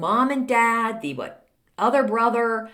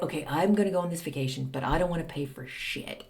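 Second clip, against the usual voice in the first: muttered freeloader thought; 5 semitones lower, 7 dB quieter than usual. Retold or imitated. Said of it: "that would make me anxious".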